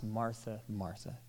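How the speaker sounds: calm her with the name, braking on the second Martha; gentle, hushed soothing; slowing down, tender. Shown as a demonstration. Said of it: "hear him slowing down the second time".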